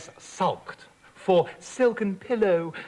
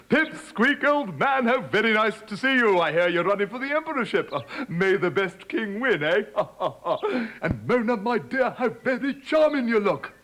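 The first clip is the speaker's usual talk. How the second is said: hearty king; 3 semitones higher than usual. Acted. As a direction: play the hero king for the crowd. King Prosperous greeting guests.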